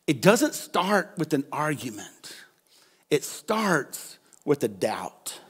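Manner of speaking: whispered, slow and grave; quiet whispered warning to the congregation; hushed. Real, not acted. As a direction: draw them in with a hush. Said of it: "preacher drops to whisper".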